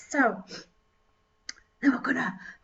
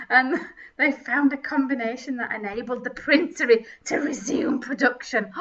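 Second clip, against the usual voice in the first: ordinary delivery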